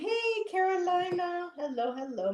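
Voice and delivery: high, singsong hello; delighted greeting. Real, not acted. Greeting Carolina as she joins, high delighted welcome. Greets newcomer joining the chat.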